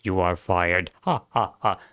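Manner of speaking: low, steady mock robot intoning; lightly voiced deadpan robot with flat ha ha. Gamer voicing robots firing the humans, in a low mock robot drone.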